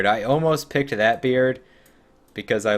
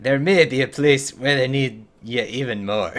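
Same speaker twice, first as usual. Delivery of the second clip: put-on louder, higher Scottish-dwarf accent on "All right, we appreciate"; 4 semitones higher, 6 dB louder than usual. Streamer reading quest text to chat in a Scottish dwarf accent. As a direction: push a thick Scottish brogue. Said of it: "I'm trying to do the accent".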